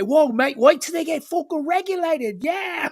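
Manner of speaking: high-pitched imitation of a British mate; slow, high mock fan banter; mock football fan. Mimics British football talk.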